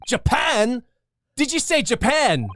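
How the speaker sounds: high, loud astonished Scottish governor; surprised governor. Acted. Governor startled by the lawyer, in a loud Scottish accent.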